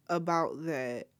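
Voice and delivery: ordinary talk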